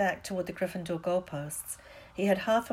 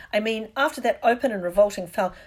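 loud, indignant match announcer; biased commentator's projected voice; partisan commentary; 3 semitones higher, 8 dB louder than usual. A narrator's reading. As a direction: commentate with open disgust. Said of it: "difficult not to take sides".

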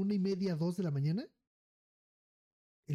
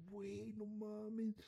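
stunned quiet aside; 4 semitones higher, 10 dB quieter, much slower than usual. Retold or imitated. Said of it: "Yo dije".

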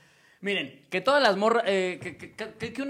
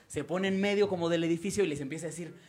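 usual speaking voice

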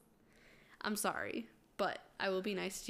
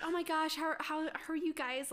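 gushing gossip; 8 semitones higher than usual. Retold or imitated. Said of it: "Everyone was like".

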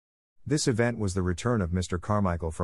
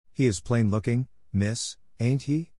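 lightly voiced maid, slow and amused; a hint of a simple servant girl, drawn out; Becky, cockney maid; 3 semitones higher than usual. A narrator's reading.

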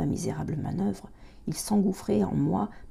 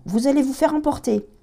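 higher, louder urgent warning; warning shout; 9 semitones higher, 7 dB louder than usual. A narrator's reading. Lieutenant warning children, shouting over the storm. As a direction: shout over the storm to hold them back. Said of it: "hurla le lieutenant".

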